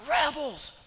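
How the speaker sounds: high, loud furious cry; angry shout. Acted. Moses shouting at the people after meeting God, furious. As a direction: spit the word out in rage. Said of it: "and your response is".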